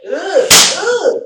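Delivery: disgusted yelps at a bug; loud grossed-out cries; screaming at a spider. Real, not acted.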